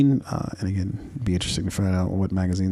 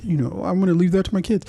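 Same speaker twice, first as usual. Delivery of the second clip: smug critics; 7 semitones higher than usual. Retold or imitated. People scolding the kids' critics, mock-preachy higher voice. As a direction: lecture about building a legacy, hammed up. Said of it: "a lot of people who are gonna be like".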